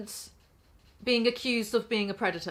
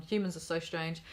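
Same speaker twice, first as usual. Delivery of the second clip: ordinary talk, nothing marked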